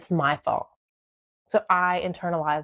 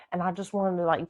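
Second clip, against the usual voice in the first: ordinary talk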